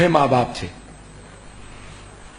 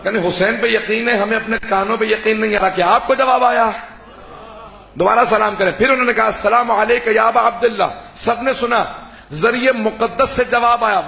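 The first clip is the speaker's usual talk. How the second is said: preaching; 7 semitones higher than usual. Acted. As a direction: preach it to the gathering with full fervour. (بلند آواز میں پرجوش خطابت)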